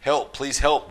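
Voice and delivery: ordinary delivery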